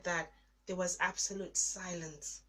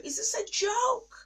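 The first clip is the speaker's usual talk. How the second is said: much higher, louder, pleading disbelief; anguished high cry; 11 dB louder than usual. Retold or imitated. Alone in the bathroom, crying out to God in anguish. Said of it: "And I'm like".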